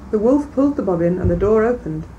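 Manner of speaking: ordinary delivery, nothing marked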